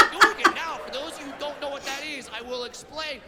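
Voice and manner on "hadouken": loud, high-pitched game attack cry; retold battle yell. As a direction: yell a special move at an attacker. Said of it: "And I just yelled it out".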